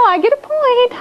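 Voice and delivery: high, teasing crow of triumph with a laugh; mock-gloat, pitch jumping up, then laugh; playful gloating. Teacher teases pupils who broke the signal game, gloating.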